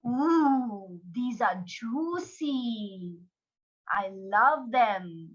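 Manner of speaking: very slow, drawn-out relish; savouring crocodile. Acted. Storyteller as crocodile enjoying mangoes, slow savouring voice. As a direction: linger on every word as if tasting it. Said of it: "and he eats".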